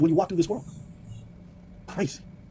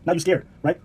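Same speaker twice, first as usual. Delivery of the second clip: ordinary delivery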